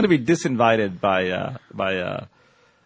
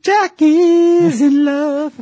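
mock swoon; 13 semitones higher, 7 dB louder, much slower than usual. Teasing singsong about listener.

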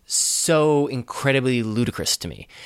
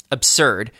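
normal talking voice

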